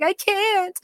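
very high, drawn-out mock wail; retold protest. Patient told to turn over, high mock wail of protest. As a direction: imitate yourself whining in labor, pitch way up. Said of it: "I was like".

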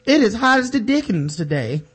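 mock Southern lady fussing about the heat; put-on Southern charm for the camera; Southern belle accent. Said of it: "the cameras are back on".

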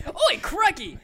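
high, loud Aussie exclamation through laughter; laughing, hammed-up Australian accent; mock Australian accent. Echoing friend's Australian joke, mock Australian accent. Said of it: "Australian people's accent is just like country".

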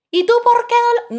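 mock scolding question. Retold scolding of older boy.

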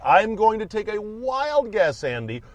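high, slow, drawn-out radio host voice; Ken Beatrice impression. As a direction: stretch every word slowly, pitched high. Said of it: "as Ken Beatrice would say".

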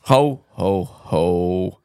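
low Santa ho ho ho; jolly ho ho ho. Acted. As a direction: laugh like Santa Claus. Santa laugh.

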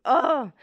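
exasperated groan. Real, not acted. Groaning that [name] beat her.

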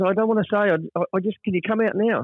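high, hesitant imitated panic; anxious plea. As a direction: stammer it out anxiously. Longy still panicking.

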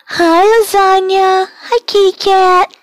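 high squeaky pet voice. Real, not acted. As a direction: coo hello to the kitty. Greeting the cat.

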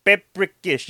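ordinary delivery

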